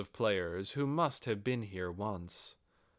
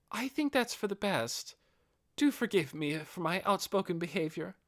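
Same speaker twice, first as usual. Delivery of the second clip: higher, hesitant loyal servant voice; a lightly voiced noble lady trailing off; devoted female retainer; 9 semitones higher than usual. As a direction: report it, then falter. Albedo thanks and apologizes.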